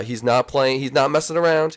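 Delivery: ordinary talk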